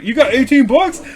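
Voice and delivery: high, loud imitated disbelief; astonished question. Friend hearing of the eighteen-dollar payout, astonished.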